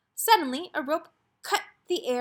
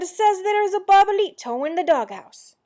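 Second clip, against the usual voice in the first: loud, high storybook excitement; excited announcement; 9 semitones higher, 10 dB louder than usual. A narrator's reading. Kitten reporting what a bird says, excited announcement. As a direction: proclaim the big news, pitched up and loud.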